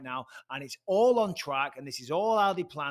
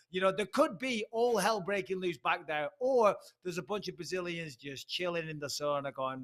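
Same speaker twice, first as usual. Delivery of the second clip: normal talking voice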